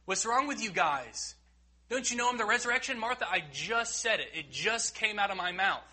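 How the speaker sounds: high, impatient scolding, not what Jesus says; mock exasperation. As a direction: play an exasperated teacher, mockingly. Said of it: "he doesn't in the moment say".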